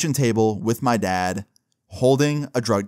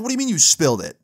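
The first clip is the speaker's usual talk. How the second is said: higher, mildly sharp questioning; retold incredulous mom; 6 semitones higher, 3 dB louder, faster than usual. Mom questioning teen about the spill, incredulous. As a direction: imitate a mom in disbelief.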